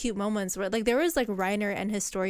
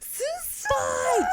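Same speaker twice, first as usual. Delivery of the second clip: much higher, full shout; mock battle shout. Imitating Erwin's suicide charge, high shout. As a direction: yell it like a doomed charge. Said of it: "he's like".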